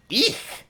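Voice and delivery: high-pitched little shriek; high squeal. Acted. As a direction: squeal in mock fright. Squeal at the vomit.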